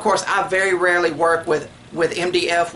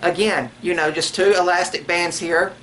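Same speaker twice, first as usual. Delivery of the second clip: ordinary talk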